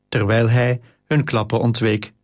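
ordinary delivery

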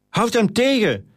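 high-pitched storyteller's shout, no real volume; urgent shout. A narrator's reading. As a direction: call urgently to friends to stop someone. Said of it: "schreeuwde Titus naar zijn vrienden".

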